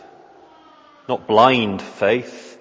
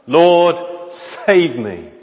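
desperate, higher, louder plea of a drowning man; crying out for help; 7 semitones higher, 4 dB louder, slower than usual. Acted. Peter sinking in the waves, crying to Jesus: desperate loud plea. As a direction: cry out like you're going under. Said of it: "He cries out to Jesus".

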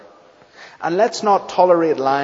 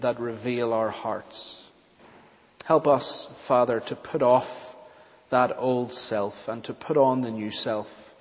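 lower, hushed closing prayer; solemn prayer; 4 semitones lower, 5 dB quieter than usual. Real, not acted. Preacher asks the Father to forgive many sins, solemn prayer. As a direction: pray humbly, sinking softer toward the amen.

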